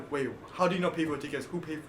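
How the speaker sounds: ordinary delivery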